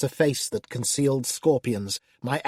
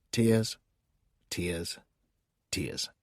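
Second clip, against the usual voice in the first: each word softer and lower until almost gone; fading away; 7 semitones lower, 6 dB quieter, much slower than usual. Acted. Demon telling the reader only tears are left, fading out. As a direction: let the word die away.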